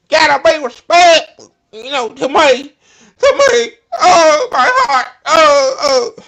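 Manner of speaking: high, loud mock Southern lady, then a slow laughing "Oh, my heart"; mock Tennessee accent. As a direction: ham up the Tennessee lady clutching her heart. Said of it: "that damn country ass Tennessee accent".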